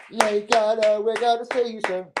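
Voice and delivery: high put-on menacing voice; sing-song warning to Lee Gunner; mock threat. Taunting Lee Gunner on stream, high mock-menacing voice.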